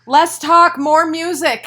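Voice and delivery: high, loud fake heckle; mock shouted heckle. Host shouting a mock heckle at the singer. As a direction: bellow it like a crowd chant, tongue in cheek.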